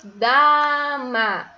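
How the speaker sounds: "Dama" as a long, loud "DAAA" then "MA"; syllable by syllable. Shown as a demonstration. Teacher showing pupils how often the mouth opens, "Dama" in stretched syllables. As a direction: open wide on each beat of "Dama". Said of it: "Eu abri quantas vezes minha boca".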